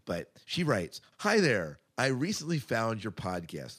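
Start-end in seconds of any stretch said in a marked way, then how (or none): none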